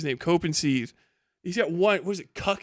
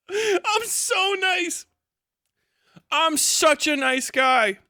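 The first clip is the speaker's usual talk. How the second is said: sarcastic; 8 semitones higher, much slower than usual. Pretend. Sarcastic self-praise.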